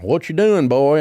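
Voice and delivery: loud mock sheriff challenge; mock small-town sheriff. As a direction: play the big-belly sheriff pulling over a speeder. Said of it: "he's like".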